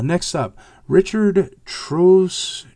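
ordinary talk, nothing marked

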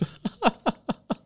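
high-pitched chuckle; laughing. Real, not acted. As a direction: giggle at your own story in short high bursts. Amused at how she sees him.

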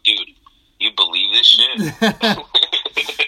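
barely holding in the laughter; incredulous aside. Retold or imitated. To a buddy mid-prank, slow, high incredulous drawl. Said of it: "because you're like".

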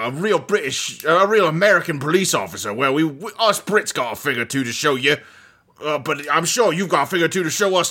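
a fawning British cop, in a bad English accent; shitty British accent. British sidekick cop flattering Columbo, mock British accent. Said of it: "me doing shitty British accents".

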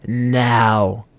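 grated one-word warning; dangerous rasp. A narrator's reading. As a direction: rasp it like a threat. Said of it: "she said in a dangerous rasp".

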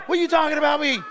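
Isaac protesting, high loud shout; mock indignant shout. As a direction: shout back in outrage. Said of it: "Isaac's like".

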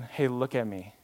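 soft, gentle call for attention; quietly tender, eye to eye; loving. Acted. God kneeling to the one waiting, loving. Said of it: "He gets on his knees".

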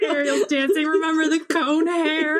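high laughing half-sob; laugh-cry through the memory; mock happy crying. Friends reliving the ride, laughing and mock-sobbing.

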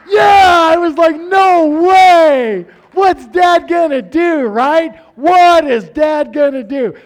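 high, loud laughing glee; gleeful laughing. Retold or imitated. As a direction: cackle with delight. Kid gleeful at dad's punishment.

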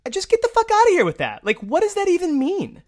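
high, loud incredulous dismissal; real exasperation, voice jumping high; exasperated outburst. Real, not acted. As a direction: throw it out in disbelief, pitch shooting up. Ranting at a quote just read.

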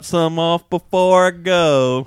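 loud, slow put-on text voice. Friend mimics buddy texting before meeting the boys, slow mock accent. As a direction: ham up the message, dragging the words out. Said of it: "It's a little bit slower".

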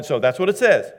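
ordinary talk, nothing marked

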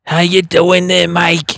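high, loud chummy greeting from a longtime smoker; raspy smoker lady. Retold or imitated. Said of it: "she'd be like".

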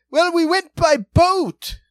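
high, loud, slow hammed-up comic; gag guy, wavering accent. The gag guy answers on the ramparts, mock shouting in character. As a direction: imitate a floundering faire comic with a put-on accent. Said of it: "floundering bits in wavering accents".

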